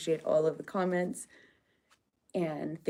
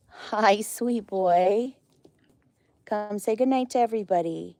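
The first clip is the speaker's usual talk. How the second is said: pet talk; 4 semitones higher, 6 dB louder than usual. Real, not acted. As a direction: coo at a sweet pet. Greeting a pet alpaca.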